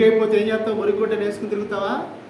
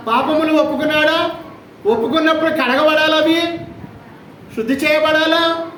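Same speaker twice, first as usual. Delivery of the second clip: raised, louder preaching questions; preaching at full force; 7 semitones higher, 3 dB louder than usual. Acted. Preacher challenging the congregation about baptism, forceful preaching. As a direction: press the congregation hard with each question.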